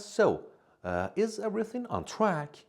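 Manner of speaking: a hint of a cheerful friend checking in; voicing Rachel. Teacher voices Canadian friend Rachel.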